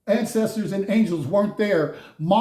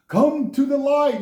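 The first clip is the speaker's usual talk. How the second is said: spooky movie call; 7 semitones higher, 3 dB louder than usual. Retold or imitated. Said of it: "like in the Poltergeist movie".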